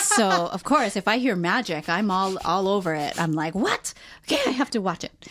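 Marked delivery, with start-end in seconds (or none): 3.5-5.3 high-pitched, astonished "what?" then quick resolve; excited reaction. Retold or imitated. Telling partner how magic hooks her, excited reaction. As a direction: act out being instantly hooked.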